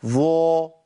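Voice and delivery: ظا with overdone lip rounding; wrong ظا demo. Shown as a demonstration. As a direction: push the lips out too far on ظا. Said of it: "ما أقولش".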